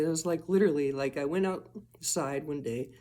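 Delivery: ordinary talk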